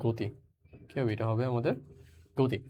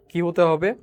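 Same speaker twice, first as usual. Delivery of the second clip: calls the question out loudly, pitched up; loud quiz question; 4 semitones higher, 7 dB louder than usual. Real, not acted. Teacher loudly quizzing students on motion, raised questioning voice. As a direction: fire the question at the class.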